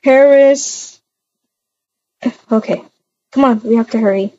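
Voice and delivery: normal talking voice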